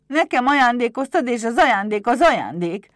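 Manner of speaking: loud, high-pitched protest; defiant outburst, clinging to what is his; indignant kobold. A narrator's reading. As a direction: shout it like an outraged little imp. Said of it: "Villámló szemmel nézett Éderre".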